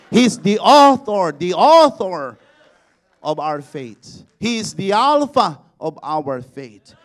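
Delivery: loud, ringing pulpit proclamation; rising revival preaching; full-force preaching. Acted. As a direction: preach it out to the whole hall.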